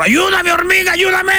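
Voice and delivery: very high shout for help; donkey begging help. Acted. Drowning donkey to the ant, high desperate shout. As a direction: plead at the top of your range. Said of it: "Y le dijo el burro".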